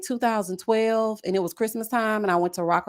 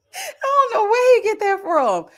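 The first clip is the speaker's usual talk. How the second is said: high, loud, drawn-out exclamation; amused bewilderment; 12 semitones higher, 5 dB louder than usual. Real, not acted. Mother joking about where her son gets his appetite, amused bewilderment. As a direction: wail it slowly in mock bewilderment.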